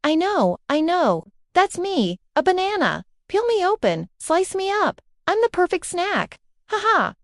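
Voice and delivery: eager, bouncy and high; mildly excited banana voice. A narrator's reading. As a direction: blurt it out, proud of knowing. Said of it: "Bana jumped up and down".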